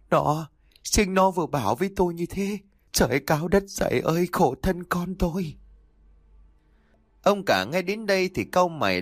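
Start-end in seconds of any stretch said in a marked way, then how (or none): 0.1-5.6 higher, stumbling mother's lament, shaken; trembling stammer. A narrator's reading. Wife insists to husband their daughter spoke, trembling lament. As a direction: stammer it out as if knees are giving way. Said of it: "miệng vẫn còn ấp úng, đầu gối run rẩy".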